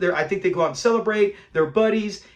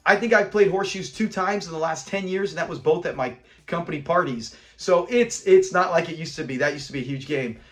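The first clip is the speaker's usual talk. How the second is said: ordinary talk, nothing marked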